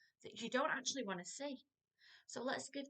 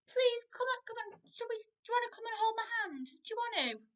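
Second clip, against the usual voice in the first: much higher, pleading coax, faster in the middle; pleading parent; 14 semitones higher, 4 dB louder than usual. Shown as a demonstration. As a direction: chase a runaway child with begging questions. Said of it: "If I was running off".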